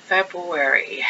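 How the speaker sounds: hushed aside; soft hushed word. Acted. Whispered repeat.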